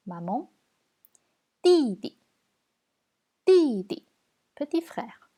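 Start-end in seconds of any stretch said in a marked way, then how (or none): 1.6-4.1 "Didi" loud high falling syllable, then a light one; neutral tone. Shown as a demonstration. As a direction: drop the first "Di" from high, keep the second short. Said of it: "on va voir le ton neutre".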